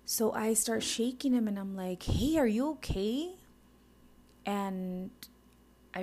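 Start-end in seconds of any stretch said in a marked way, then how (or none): 2.0-3.4 1 alarmed. Retold or imitated. Retold shaking of unresponsive husband.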